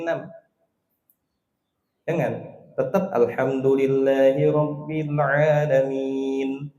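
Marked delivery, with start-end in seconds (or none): 2.7-6.7 alhamdulillahirabbil with ain held only two beats, slow and even; plain short vowels. Shown as a demonstration. Teacher shows the correct short reading, slow and even. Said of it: "Dua harokat aja cukup".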